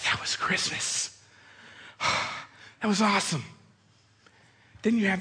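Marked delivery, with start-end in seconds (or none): 0.0-3.4 breathless satisfaction. Retold or imitated. Collected breath after chaos.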